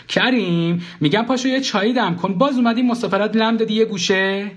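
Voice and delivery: high-pitched nagging; high, impatient wifely complaint. Acted. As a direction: scold a lazy husband. Wife nags husband, kicking him.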